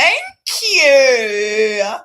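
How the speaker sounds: loud, high mock Australian thanks; Australian accent. Thanking the host back in a loud mock Australian accent. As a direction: thank them in a hammed-up broad Australian accent. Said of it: "this is definitely Australian".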